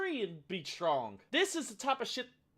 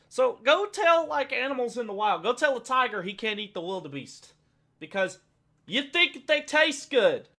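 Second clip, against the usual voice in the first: heated rant; 5 semitones higher, 9 dB louder than usual. Real, not acted. Arguing with vegan activists, heated loud rant. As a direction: push the point hard.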